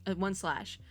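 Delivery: ordinary talk, nothing marked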